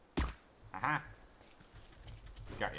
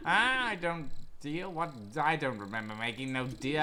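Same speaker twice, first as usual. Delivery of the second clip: feigned ignorance; 4 semitones higher, 4 dB louder than usual. Acted. Boy denies the frog deal to lady, playing dumb. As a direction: play dumb with exaggerated bafflement.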